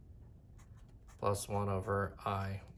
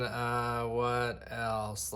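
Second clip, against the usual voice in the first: ordinary talk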